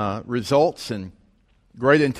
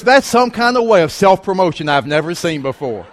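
loud, raised-pitch retold jibe; mock amazed reproach; 5 semitones higher, 6 dB louder than usual. Colleague teases the author about self-promotion, loud mock amazement. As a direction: call out the shameless plug, hammed-up.

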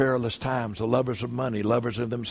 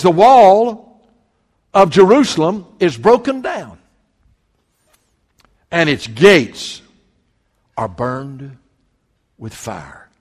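loud, booming preacher's reading that fades to a hush; proclaiming the broken walls; forceful scripture reading; 3 semitones higher, 11 dB louder, slower than usual. Acted. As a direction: declaim the verse, then let it die away. Preacher reads the ruined walls verse.

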